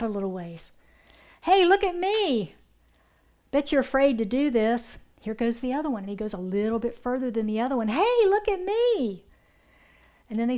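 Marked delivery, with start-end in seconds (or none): 1.4-5.0 put-on showing-off kid. 7.9-9.2 put-on showing-off kid. Second kid fish showing off.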